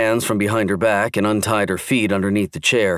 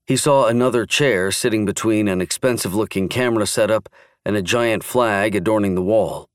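plain reading voice

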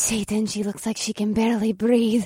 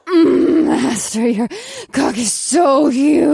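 ecstatic shout; 4 semitones higher, 5 dB louder, slower than usual. Acted. Character in ecstasy.

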